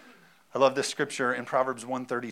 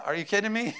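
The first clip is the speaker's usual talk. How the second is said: ordinary talk, nothing marked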